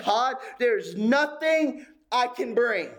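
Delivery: high, earnest prayer voice, pitch lifted well above his preaching; pleading prayer. Acted. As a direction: plead with God from an empty-handed heart.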